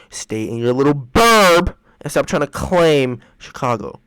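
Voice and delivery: loud scolding shout at suburb folks; angry yell. Real, not acted. Cohost scolds suburbanites faking Chicago roots, yelling. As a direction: yell it, telling them off.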